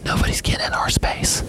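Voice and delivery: hushed whisper; whispered mock warning. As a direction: breathe it like a secret. Whispered aside about space.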